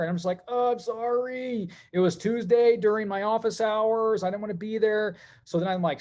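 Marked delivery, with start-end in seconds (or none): 0.5-5.2 mock apology. Apologizing to his patients, mock sorry and slowed right down. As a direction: lay the fake apology on thick.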